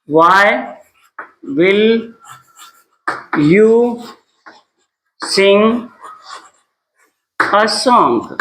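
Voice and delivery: every word separate, with long gaps; spaced-out teacher's dictation. Shown as a demonstration. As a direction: dictate it slowly, one word at a time.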